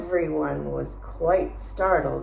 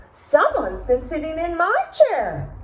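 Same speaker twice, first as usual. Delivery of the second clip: Mama Bear yelping; 11 semitones higher, 4 dB louder than usual. A narrator's reading. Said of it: "Mama Bear yelped".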